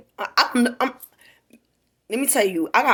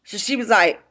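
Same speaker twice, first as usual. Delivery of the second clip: usual speaking voice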